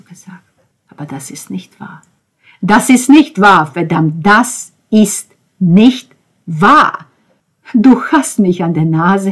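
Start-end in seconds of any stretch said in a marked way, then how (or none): 2.6-7.1 angry denial. Acted.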